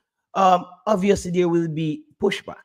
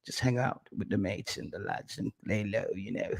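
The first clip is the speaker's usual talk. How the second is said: hushed, coaxing imitation of persuasion, much softer; whispering; 4 semitones lower, 7 dB quieter than usual. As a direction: lean in and murmur it like a sly recruiter. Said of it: "start whispering at me and say".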